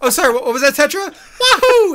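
high loud Mario voice. Retold or imitated. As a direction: ham it up as Mario. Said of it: "It's like".

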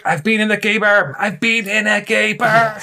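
high-pitched, over-eager mock straight guy; straight guy boasting. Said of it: "a guy and they're like".

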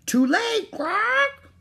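high loud squawking parrot. Acted. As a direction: screech it like a bird. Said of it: "the parrot squawked again".

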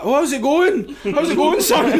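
high, loud mock-Scottish greeting; sudden Scottish accent. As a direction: switch on a hammed-up Scottish accent in a panic. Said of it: "To turn it on really quick".

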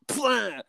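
high-pitched squashing noise; imitated splat. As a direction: make a splat sound effect.

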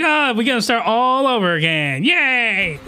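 a hint of a cheerful tormentor; mock-cheery villain line with a laugh; light gloating voice; lightly voiced gleeful villain. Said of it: "and then it's like".

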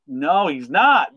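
slow, drawn-out protest; mild mock protest; slow insistent denial. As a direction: insist slowly, half-mock. Viewer protesting at screen.

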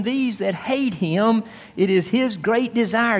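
ordinary delivery

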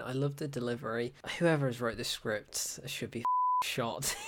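ordinary talk, nothing marked

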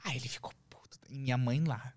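hushed gossip whisper; whispering the juicy part to the host. Real, not acted. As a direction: drop to a secret whisper. Confiding aside about the fight.

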